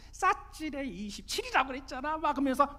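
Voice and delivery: high, gleeful taunt; teasing gloat. Acted. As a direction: rub it in like a winner. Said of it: "메로메로 하면서 가더라는". (메롱하듯 약 올리기)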